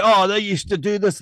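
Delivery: high, sneering mimicry of critics; mock their line in a put-on voice; lightly voiced sneer at opponents; mocking quote. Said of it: "You say".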